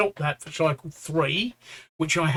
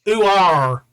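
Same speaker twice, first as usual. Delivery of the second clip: louder, broad Suffolk yokel voice; Suffolk accent; 2 semitones higher, 8 dB louder than usual. Acted. Man calls the dog in a hammed-up Suffolk accent. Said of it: "I better say it in a Suffolk accent".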